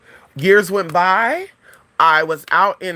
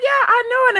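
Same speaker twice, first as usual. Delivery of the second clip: very high mock-excited confirmation; excited recognition. Cousin recognising a long-lost relative, high excited voice. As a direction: imitate a man pleased to have guessed right.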